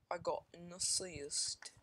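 slow, hammed-up foreign accent on "I got an assist"; bad mock accent. Shown as a demonstration. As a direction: stretch out "I got an assist" in a deliberately terrible accent. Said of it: "It's like the worst accent in the entire world".

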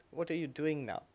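put-on soft, quiet kindly question from an old teacher; very gentle. Aged guru softly asking the young student, gentle and quiet. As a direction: ask it tenderly, almost under your breath. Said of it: "he very gently said".

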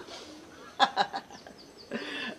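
long breathy laugh after punchline; real, drawn-out chuckling; hearty laugh. Real, not acted. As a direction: laugh out at your own joke.

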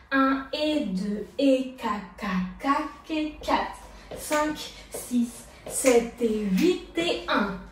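drawn-out, spaced counting; slow dance count. Shown as a demonstration. Dance teacher takes it gently from the start, slow count. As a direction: count out each beat slowly and evenly. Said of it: "On va recommencer tout ça du début, doucement".

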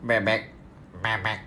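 nose held shut; pinching nose. Acted. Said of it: "bebek tapi hidungnya ditutup rapat".

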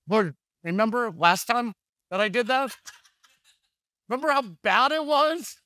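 high, laughing plea to the Lord; mock pleading. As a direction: plead with God, half laughing.

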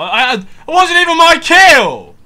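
high-pitched, full-volume protest; real rage at the game; outraged shout. Real, not acted. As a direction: yell it in sheer gamer outrage. Gamer raging at stolen kill.

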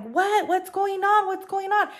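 high, urgent what's going on; confused, rising alarm. Retold or imitated. Asking amid the panic.

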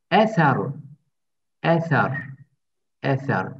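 Eser with a lisped th in the middle. Shown as a demonstration. Teacher drilling Eser for students, lisped th. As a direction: say Eser as a-tha-r, tongue out for the th. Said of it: "ince okutuyoruz".

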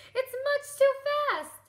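high, loud frightened shriek; a loud panicky high cry. Acted. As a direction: shriek it in alarm. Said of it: "Poor Holly shrieked".

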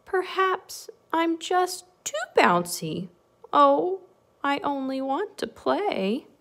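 mildly acted wistful bunny; dejected little bunny. A narrator's reading. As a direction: sound gently hurt, drawing it out. Rejected bunny talks to himself.

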